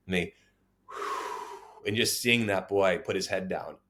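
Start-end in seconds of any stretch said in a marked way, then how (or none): 0.9-1.6 long soft exhale; re-enacted sigh of relief; relieved sigh. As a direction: let the breath out slowly. Relief after the judge's ruling.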